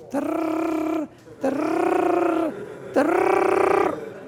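high, loud rolled trrr like a ringing alarm; alarm clock ring. Retold or imitated. Alarm clock wakes the groom at three, loud trill. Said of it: "the alarm clock went off".

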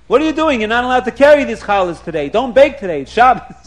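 retold indignant rebuke; scolding. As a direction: rattle off prohibitions at shoppers. Rabbi scolds people in the bakery.

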